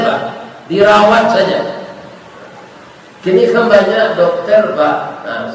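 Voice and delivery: normal talking voice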